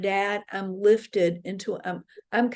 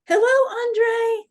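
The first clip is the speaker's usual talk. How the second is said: high, loud singsong hello; re-enacted big happy greeting; effusive greeting; 14 semitones higher, 6 dB louder than usual. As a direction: call out to a dear friend, pitched way up. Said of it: "And I would say".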